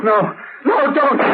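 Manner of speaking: high desperate no; terrified cry. Acted. Butler screaming as Rigo is told to fire, terrified. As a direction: cry out in terror.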